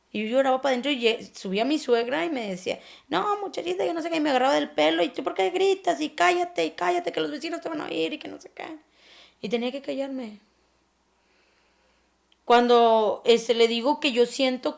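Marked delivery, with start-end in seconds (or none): 3.1-8.8 higher-pitched mock scolding of the mother-in-law; a hint of a shrill, bossy mother-in-law. Mother-in-law yelling, retold. (imitación ligera de la suegra regañando)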